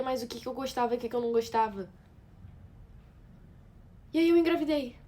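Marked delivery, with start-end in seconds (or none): 4.1-5.0 higher, louder, with joy; happy announcement. Acted. Woman recalling she got pregnant, happily excited. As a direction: share the big news brightly and high.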